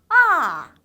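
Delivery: loud 아 falling steeply from high; fourth tone. Shown as a demonstration. Teacher showing fourth tone, 아 sharply falling. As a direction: start 아 high and let it slide right down. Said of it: "쭉 미끄러져야 돼요".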